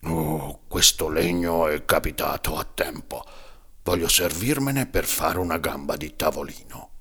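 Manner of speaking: a hint of an old man mumbling to himself; a touch of gruff old carpenter; lightly voiced muttering old carpenter. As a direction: mutter it half aloud, pleased. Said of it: "borbottò a mezza voce".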